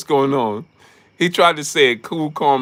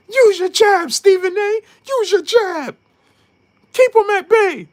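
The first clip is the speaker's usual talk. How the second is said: high, loud coaching calls from the corner; mock Freddie Roach; 5 dB louder than usual. Freddie Roach coaching Stephen A from the corner, high and loud. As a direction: bark corner advice in a squeaky put-on voice. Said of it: "Imagine you seeing Freddie Roach".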